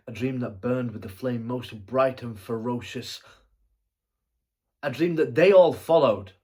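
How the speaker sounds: loud theatrical swell, rising to a cry; impassioned proclamation. Acted. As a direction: build to a ringing, high-pitched declaration. Would-be king recalls his dream.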